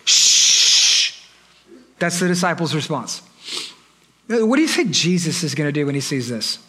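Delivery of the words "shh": rebuking shush. Acted. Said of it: "a grown man rebuking a child".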